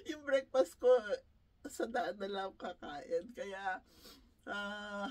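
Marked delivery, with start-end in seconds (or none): none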